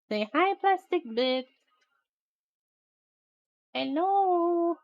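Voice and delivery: high sing-song hello for the pet. Real, not acted. Streamer has her pet greet viewers, high cooing voice. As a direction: coo hello on the pet's behalf.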